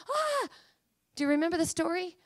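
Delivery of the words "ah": high, startled "ah"; frightened gasp-cry. Acted. Preacher acting out Isaiah scared at seeing God, high fearful cry. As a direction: cry out as if struck with awe.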